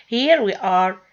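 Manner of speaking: ordinary delivery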